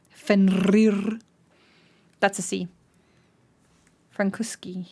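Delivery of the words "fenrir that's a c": ordinary talk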